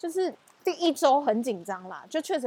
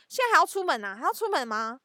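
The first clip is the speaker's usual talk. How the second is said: higher, insistent nagging like a patrol officer; hall monitor scolding; 6 semitones higher than usual. Retold or imitated. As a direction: scold your brother like a strict patrol. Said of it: "纠察队，".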